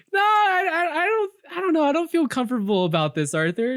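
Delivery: high-pitched stammering reluctance, easing down; traumatized protest. Acted. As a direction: stammer an uneasy, high objection. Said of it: "like, P T S D".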